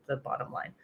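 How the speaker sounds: soft unvoiced aside; whispered. Real, not acted. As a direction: whisper the bottom line.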